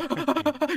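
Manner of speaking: squeaky burst of laughter; startled high laugh. Real, not acted. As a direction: laugh in shock.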